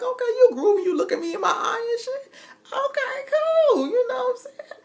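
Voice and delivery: very high, loud, giddy falsetto; flirty younger self.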